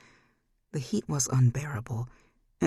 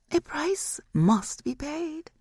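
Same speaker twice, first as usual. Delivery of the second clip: high, slow, otherworldly; high slow eerie demon verdict; slow demon pronouncement; 13 semitones higher, slower than usual. Acted. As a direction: lay down the price like a curse. Demon names the price.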